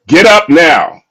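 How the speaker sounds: loud, high yell of the wife; shouted order. Retold or imitated. Wife yells at husband to deal with baby, shouting. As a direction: yell it across the room.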